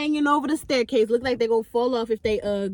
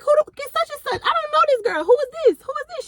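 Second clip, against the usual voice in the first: high, disbelieving questions; questioning the friend, disbelieving; incredulous; faster than usual. Retold or imitated. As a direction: demand who this girl is.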